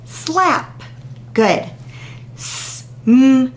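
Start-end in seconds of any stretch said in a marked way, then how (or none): 2.3-3.5 s, m, i, le said apart; segmented sounds. Shown as a demonstration. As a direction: stretch each sound of smile. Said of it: "Your turn".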